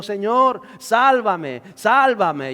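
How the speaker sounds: high, loud cry for rescue; desperate shout. A narrator's reading. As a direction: scream it like you're sinking. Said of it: "gritó diciendo". (Pedro grita pidiendo auxilio mientras se hunde)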